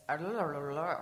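imitating tongue-tied, slurred babble; garbled speech. Speaker shows how her words came out to her friend, garbled. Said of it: "it came out as".

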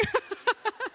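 high-pitched run of laughter; real giggling. Real, not acted. Still amused by the interruption.

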